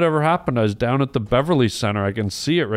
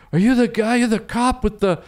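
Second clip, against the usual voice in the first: put-on excited kids; 9 semitones higher than usual. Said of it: "they go".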